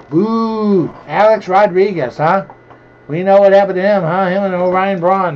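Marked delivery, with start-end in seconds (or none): 0.1-0.9 mock boo. Booing Alex Rodriguez card.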